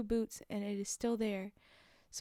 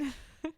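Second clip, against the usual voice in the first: short laugh; 4 semitones higher, 5 dB quieter than usual. Real, not acted. Reader reacts to the story.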